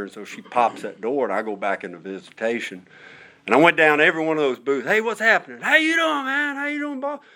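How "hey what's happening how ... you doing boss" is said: much higher, hearty hellos called down the row; excited greeting. Retold or imitated. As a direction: greet each inmate like an old buddy. Greeting inmates booth to booth.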